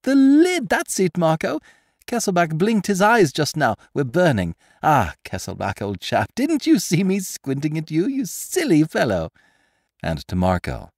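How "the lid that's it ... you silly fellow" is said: much higher, louder gleeful cry; revelling with delight. Acted. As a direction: savour being right, almost giddy. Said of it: "He revelled with delight".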